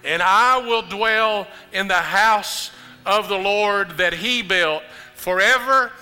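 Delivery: loud, high, impassioned funeral preaching; preaching at full force. Acted. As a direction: build to a roaring climax over the music. Preaches funeral climax over music.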